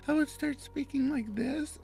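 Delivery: very high, put-on female voice; falsetto woman's voice. Shown as a demonstration. Narrator mocks their early voice acting, falsetto woman's voice. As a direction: pitch it way up into a silly falsetto woman. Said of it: "every time a woman's voice would come".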